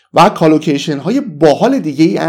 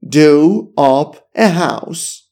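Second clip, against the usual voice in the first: very slow, at usual pitch; slow level repeat; much slower than usual. Shown as a demonstration. Teacher repeating do up, very slowly. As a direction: repeat it slowly without the lift. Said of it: "دو آپ کنی".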